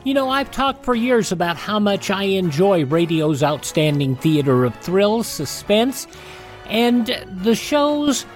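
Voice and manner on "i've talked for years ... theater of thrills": ordinary delivery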